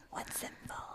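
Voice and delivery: soft whispered tease; whispering. Real, not acted. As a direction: whisper the question teasingly. Teasing question repeated in whisper.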